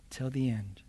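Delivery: till the end whispered, soft and falling; whispered echo. Shown as a demonstration. Preacher repeating the phrase as a hushed whisper. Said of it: "repeated softly".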